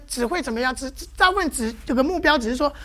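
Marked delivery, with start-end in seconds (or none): none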